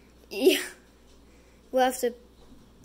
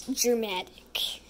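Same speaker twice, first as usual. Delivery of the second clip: dramatic whisper; 2 semitones lower, much slower than usual. Shown as a demonstration. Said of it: "makes this video way more dramatic".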